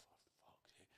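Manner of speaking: breathy whisper under the breath; whispered inner curse. Retold or imitated. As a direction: hiss it to yourself in dismay. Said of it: "I thought".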